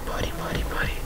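soft unvoiced whisper, coaxing. Real, not acted. Player coaxing the piglin trader in a whisper. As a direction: breathe it to lure the trader.

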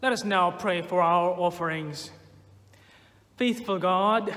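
ordinary talk, nothing marked

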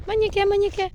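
high, loud call after the cat. Real, not acted. Owner calling the cat that ran off, high and loud. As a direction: call her back as she runs off.

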